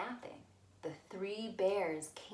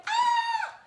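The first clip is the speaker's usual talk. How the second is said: very high loud shriek of fright; startled scream; 11 dB louder than usual. Acted. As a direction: shriek as she sees the bears. Goldilocks wakes to the bears.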